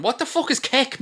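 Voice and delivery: ordinary talk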